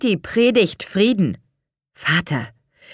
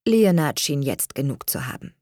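ordinary delivery, nothing marked

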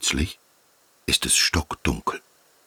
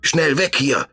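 high urgent call; alarmed shout; 11 semitones higher than usual. Acted. Hedgehog crying out to flee the pond monster. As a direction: shout them away in alarm. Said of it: "schreit Ibo".

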